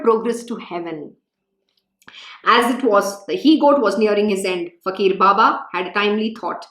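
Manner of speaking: scripture reading. Acted. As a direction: read the sacred story gently to the listeners.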